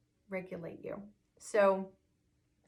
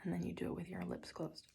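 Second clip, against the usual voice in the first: whisper; 10 dB quieter, faster than usual. Shown as a demonstration. Whispered demo lines.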